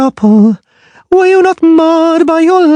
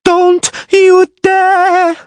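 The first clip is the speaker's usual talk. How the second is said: very high, slow, shrill furious scream; piercing scream; 4 dB louder, much slower than usual. A narrator's reading. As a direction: scream it as a raging mermaid. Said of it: "she screamed, piercingly shrilly".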